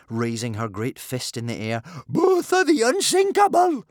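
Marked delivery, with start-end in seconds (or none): 2.1-3.9 very high, loud, proud cry; boastful shout. A narrator's reading. Bertha raising her fist, shouting her title indignantly. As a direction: bellow your champion's title high and loud. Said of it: "shouted Big Boobied Bertha".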